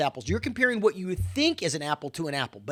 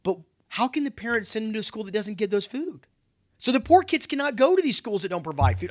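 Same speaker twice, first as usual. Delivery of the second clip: ordinary talk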